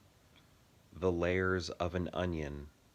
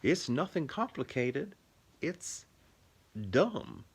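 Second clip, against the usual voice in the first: put-on Southern accent; 9 semitones higher, 4 dB louder than usual. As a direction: mimic a Southern character, pitched well up. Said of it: "you're doing that as a Southern accent".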